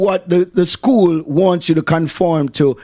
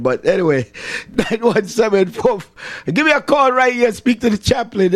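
ordinary talk

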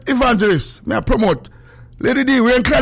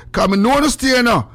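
usual speaking voice

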